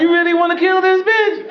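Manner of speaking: high piping voice of the Lord; the Lord's voice. Retold or imitated. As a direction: play God as a squeaky questioner. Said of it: "He said".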